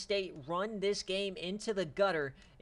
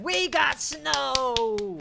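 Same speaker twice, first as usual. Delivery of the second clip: excited; 9 semitones higher, 11 dB louder, much slower than usual. Real, not acted. Excited reveal of snowy game.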